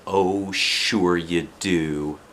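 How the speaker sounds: slow, lightly voiced mother brushing it off; a hint of a mother shrugging off the worry; mother reassuring. A narrator's reading. Mother answering her daughter at bedtime, slow and easy. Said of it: "she said".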